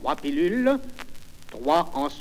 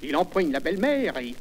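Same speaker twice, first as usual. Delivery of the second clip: normal talking voice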